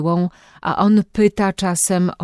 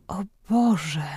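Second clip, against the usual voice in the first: moan; 6 dB quieter than usual. A narrator's reading. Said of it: "jęknęła".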